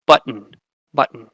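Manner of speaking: "button" ending in a syllabic N, no vowel, butt-n. Shown as a demonstration. Teacher to class, showing a syllabic consonant: vowelless N. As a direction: close "button" straight onto a humming N. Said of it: "the nucleus of the second syllable is an N".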